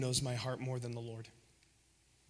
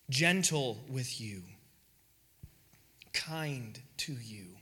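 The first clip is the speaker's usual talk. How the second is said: tender and gentle; 4 semitones lower, slower than usual. Real, not acted. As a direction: comfort them. Drops soft after climax.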